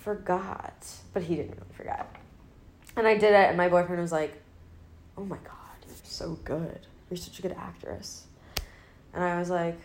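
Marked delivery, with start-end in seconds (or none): 0.0-0.7 lightly acted absent-minded detective; Columbo. Detective pretends to remember something, lightly imitated musing. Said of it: "like he's thinking". 5.2-8.2 hushed admiration. Retold or imitated. Boyfriend praises her Columbo act, imitated in hushed awe.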